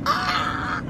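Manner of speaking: very high-pitched squeal; sustained high playful cry. Real, not acted. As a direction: let out a long, high "ah". Singing out along with music.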